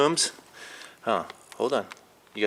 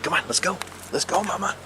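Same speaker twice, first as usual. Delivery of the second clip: talking to a cat; 7 semitones higher than usual. Real, not acted. Coaxing cat Mama to come.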